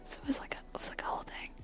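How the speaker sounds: very soft close whisper; ASMR whisper. Acted. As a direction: whisper the story into the mic.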